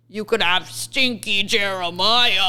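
drawn-out, scornful mock pitch through laughter; slow, laughing put-down; mock disdain. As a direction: sell the loser with mock distaste. Mock show pitch of worse brother.

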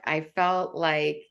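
ordinary delivery

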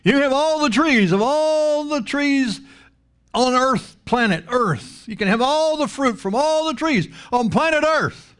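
high, expansive preacher voicing God; hammed-up high voice of generous God; God's lavish offer. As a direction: stretch each "all" high to show the abundance.

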